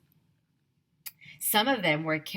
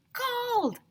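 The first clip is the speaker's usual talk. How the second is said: high excited yell; shouted. A narrator's reading. Narrator kid joining the shout about gold. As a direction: shout with the crowd. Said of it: "I shouted".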